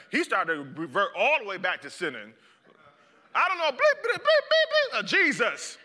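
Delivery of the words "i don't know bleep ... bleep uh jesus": much higher, louder bleeped swearing; censored cursing. Acted. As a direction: swear at them, beeped out.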